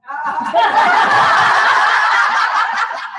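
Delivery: voiceless, airy burst of laughter; loud silent-ish giggle; breathy laugh. Real, not acted. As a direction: laugh without voice. Laughs about the accent.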